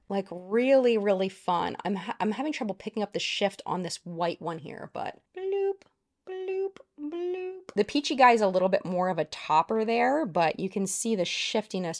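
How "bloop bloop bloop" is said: high, soft sing-song bloops; mock bubble sound effect; playful bloop. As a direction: pop each word like a bubble.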